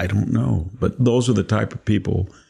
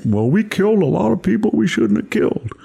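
mock confession; 11 semitones higher than usual. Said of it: "he admit".

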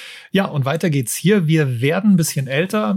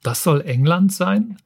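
a hammed-up, higher "Wie bitte?" in mock surprise; mock disbelief; 6 semitones higher than usual. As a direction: act lightly taken aback, playing it up. Mock disbelief opening the review. (gespielt ungläubig, etwas höher)

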